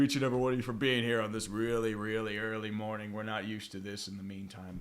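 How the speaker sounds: ordinary talk, nothing marked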